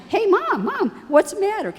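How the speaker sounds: a grown daughter chasing her upset mother; daughter calling out. Retold or imitated. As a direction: call after Mom as you run. Daughter running after crying mom.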